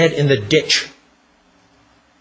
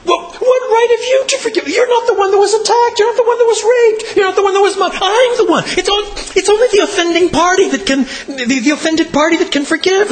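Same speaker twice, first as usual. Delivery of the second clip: outraged victim. Pretend. Preacher voicing a victim furious at the pastor, outraged high protest. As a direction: splutter with outrage as the wronged one. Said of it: "You will be outraged".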